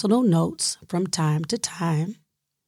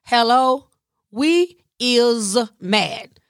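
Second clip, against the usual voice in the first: sassy, higher and slowed on we is mad; irritated inner sister girl; 4 semitones higher, slower than usual. Retold or imitated. Her irritated side scolding her, a sassy put-on voice. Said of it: "the part of me that was irritated".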